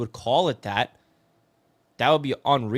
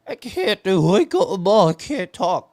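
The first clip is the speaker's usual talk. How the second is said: high, strained croak, voice gone; imitating voiceless friend; 6 semitones higher, 4 dB louder than usual. Friend calling in sick, squeaking out he can't talk. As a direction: rasp it out like you lost your voice. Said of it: "He was like".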